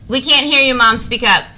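loud and high, calling out. Real, not acted. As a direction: call out loudly to Mom. Calling out to mom on phone.

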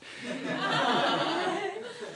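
very high laugh; squeaky-high amused laughing. Real, not acted. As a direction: break into a long, high-pitched laugh. Amused at the dramatic timing.

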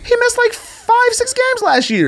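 very high, squeaky insistence; high-pitched insistence. Real, not acted. Co-host insisting the player missed games, high-pitched. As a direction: argue it stretched out, voice up at the top.

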